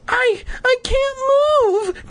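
very high, slow stammered wail. Acted. Trapped sister to brother, high stammering wail. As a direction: wail it high, hammed-up helpless. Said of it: "Terry wailed".

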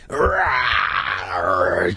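playful beast roar; animal roar. Pretend. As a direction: growl like a wild animal. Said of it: "I'm an animal".

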